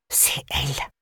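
unvoiced, barely there; whisper. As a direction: breathe the admission out. Damaged sister admits mother hit her.

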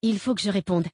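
ordinary delivery, nothing marked

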